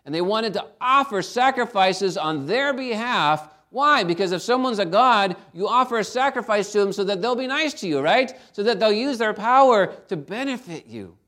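preaching. Acted. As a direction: drive the point home to the church. Preaching with rising force.

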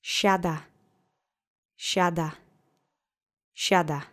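Siada with SHA stressed, said SHA-da; stressed first syllable. Shown as a demonstration. Host models Siada for repeating, SHA-da stressed first. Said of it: "The accent is on the first syllable".

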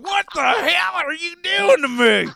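loud shrieking yell, very high; angry yell. Pretend. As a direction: scream it in outrage. Imagined old man snapping angrily.

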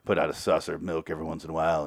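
low, sour grumble of a put-upon uncle; grumpy uncle voice. Retold or imitated. Uncle Harry griping about the kid he never wanted, low and sour. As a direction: drop low and mutter like a cranky uncle. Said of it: "He's like".

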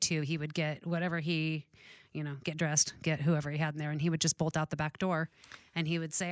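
ordinary talk, nothing marked

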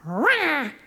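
high mock roar; imitated roar of pride. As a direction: let pride roar like a beast.

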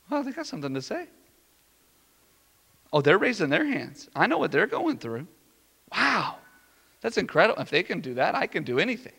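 quiet, dawning amazement to yourself; hushed wonder. Acted. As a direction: murmur it as a won-over onlooker. Murmured awe of onlooker warming up.

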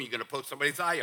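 very fast, run-together phrase; rapid recital. Shown as a demonstration. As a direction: rattle it off in passing. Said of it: "let me just say these all real fast".

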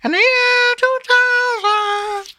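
very high, slow, loud squealing announcer; hammed-up falsetto. Friend tops the joke, screeching falsetto year two thousand. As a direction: screech it out like a comic prophet. Echoing the bit louder.

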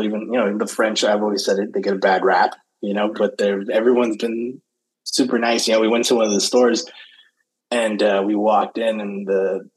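ordinary delivery

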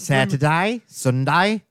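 weekend days in broad Aussie vowels; practised Australian lilt; Australian accent. Shown as a demonstration. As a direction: list them as an Australian. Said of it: "I do the days of the week".

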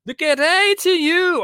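loud, high and slow, stretching every word; loud drawn-out greeting. Real, not acted. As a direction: belt the welcome out slowly like a big announcement. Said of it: "that was quite loud to start off with".

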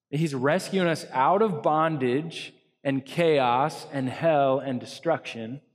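normal talking voice

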